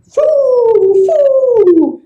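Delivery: high blown foo; blowing like wind. A narrator's reading. Wind blowing at fire, high foo. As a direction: puff hard to snuff fire. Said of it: "And wind blew".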